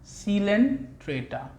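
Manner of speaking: Coelenterata drawn out slowly, each syllable clear; slow teacher's enunciation of Coelenterata. Shown as a demonstration. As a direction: spell out Coelenterata slowly for students. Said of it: "कौन सा phylum पढ़ेंगे बेटा".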